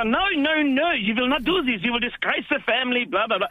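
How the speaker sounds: strict parents. Retold or imitated. Parents forbidding him from running off, mock-stern high voice.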